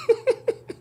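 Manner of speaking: a short high giggle. Real, not acted. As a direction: giggle at your own line. Laugh after confession.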